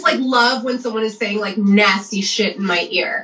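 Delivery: ordinary talk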